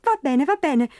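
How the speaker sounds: a hint of a flustered mumble; mumbled surrender. Leprechaun giving up to Pat, flustered mumble. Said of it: "farfugliò".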